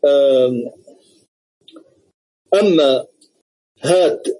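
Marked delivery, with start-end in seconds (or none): none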